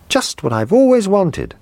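put-on high child's voice, thrilled; a little boy overjoyed; boy delighted with his birthday present. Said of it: "he cried".

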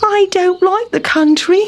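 high-pitched, sighing mouse voice; sighing complaint. A narrator's reading. Town Mouse fed up after falling, sighing. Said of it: "sighed Town Mouse".

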